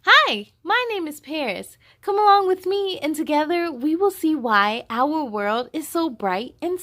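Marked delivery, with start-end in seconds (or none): none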